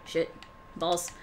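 usual speaking voice

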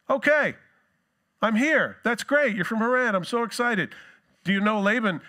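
high, loud, eager greeting; bursting with excitement at finally arriving; excited Jacob. Retold or imitated. Jacob greeting the shepherds, high and thrilled. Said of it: "and he goes".

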